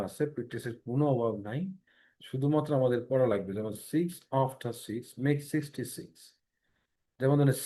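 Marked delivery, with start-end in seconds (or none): 3.9-6.1 six after six spoken very slowly, each s crisp; slow tongue twister. Shown as a demonstration. Teacher slowly models the tongue twister for the class. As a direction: read it slowly, word by word. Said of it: "tongue twister হিসেবে পড়বো".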